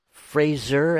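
Fraser said with a buzzing Z, slowly; Z pronunciation. Shown as a demonstration. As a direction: say "Fraser" slowly as FRAY-zer, with a Z. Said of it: "it's the Z when I say it".

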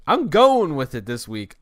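shrugging off a bad podcast, loud and slow; loud, slow resolve. Real, not acted. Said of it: "this is gonna be a horrible podcast".

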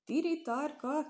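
"Tiri tarka!" cried out high and drawn out in wonder; amazed exclamation. A narrator's reading. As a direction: gasp it out, slow and astonished. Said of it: "kiáltott fel csodálkozó mezeiné". (csodálkozó, elnyújtott felkiáltás)

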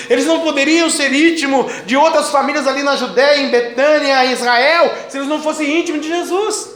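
full-force preaching. Real, not acted. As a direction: proclaim it fervently to the congregation. Prega com força total. (pregação fervorosa com voz elevada)

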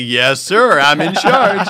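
loud, over-confident announcement; acted-out bluffing with full volume; bold claim. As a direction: declare it loudly, as if the boss. Falsely claiming to be in charge.

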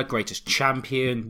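normal talking voice

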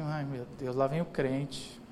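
low, soft aside of resignation; weary dread. Acted. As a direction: grumble it under the breath, low and slow. Relatives dreading the believer's speech.

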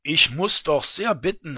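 a loud, indignant protest; protest, offended and heated. Acted. Offended traveller protests to the official, loud and indignant. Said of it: "ereiferte sich nun der Reisende".

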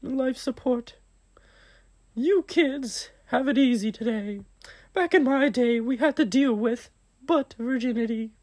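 squeaky high cartoon voice; Mickey Mouse voice. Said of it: "Mickey Mouse, is that you?".

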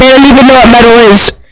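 fast, very loud outburst; sudden yell. Acted. Friend jumps on the made-up name, sudden fast yell. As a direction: yell it all in one rush.

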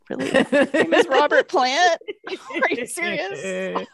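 high, slow, laughing incredulity; can't believe it, laughing; laughing disbelief. Retold or imitated. [name] hears the name [name], laughing disbelief.

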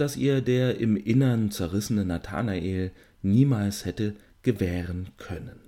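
dramatic reading aloud; story narration. A narrator's reading. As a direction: read the passage like a storyteller.

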